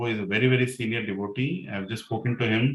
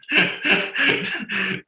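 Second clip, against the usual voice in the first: high bright burst of laughter; sudden real laugh; 11 semitones higher, 4 dB louder than usual. Real, not acted. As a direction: laughs out loud at the memory. Laughing at her accusation.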